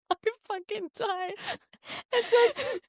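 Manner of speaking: squeaking out the words through laughter; laughing. Real, not acted. Cracking up over review line.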